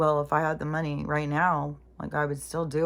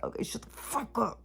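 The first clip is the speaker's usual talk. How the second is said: whispered, higher, fed-up snap at her own thoughts; hissed inner snap; 7 semitones higher, 6 dB quieter than usual. Retold or imitated. Telling her racing thoughts to stop, hissed whisper. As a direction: hiss it to silence the nagging voice in her head. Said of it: "it's just like".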